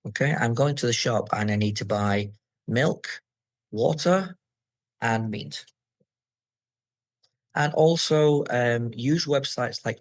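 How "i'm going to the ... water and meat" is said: up on "milk" and "water", down on "meat"; list intonation. Shown as a demonstration. Teacher practising a shopping list of three things, rise, rise, fall. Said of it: "practice that intonation".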